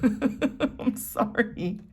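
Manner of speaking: very slow, giggling through it; laughing apology. Real, not acted. Reader apologizing to viewers, laughing. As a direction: apologize while still cracking up. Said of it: "which is why I'm laughing".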